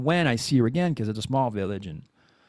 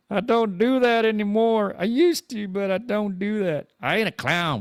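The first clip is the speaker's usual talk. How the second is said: joking high voice answering the chat; mock denial voice; 8 semitones higher than usual. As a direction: play it up in a silly high voice.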